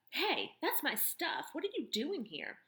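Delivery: put-on a boy catching a stranger with his stuff; indignant boy. Boy confronting woman in his stuff, startled and indignant.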